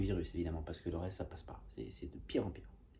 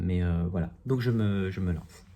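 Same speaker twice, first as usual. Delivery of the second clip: normal talking voice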